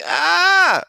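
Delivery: high shrill yelp of fright; kid's frightened "Ah!" scream; retold scared scream. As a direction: shriek like you just saw a monster. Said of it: "I was like".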